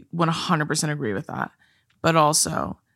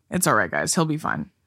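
usual speaking voice

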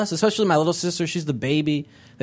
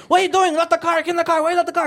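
imitating angry dad; 13 semitones higher, much faster than usual. Pakistani dad yelling at his daughter over the phone, high. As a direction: bark at her like an upset immigrant father. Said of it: "He's like".

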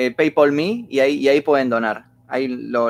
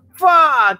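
surprised exclamation; 11 semitones higher, 6 dB louder than usual. Real, not acted. Reacting to conversion.

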